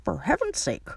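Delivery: put-on indignant old man character voice; indignant voice leaping up in pitch; disapproving grandpa. A narrator's reading. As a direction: scold like an outraged grandpa. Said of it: "said the grandpa".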